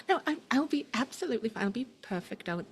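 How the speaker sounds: high, hurried reassurance; flustered young man. Acted. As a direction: brush off a fussing elder politely. Plays young man waving her off.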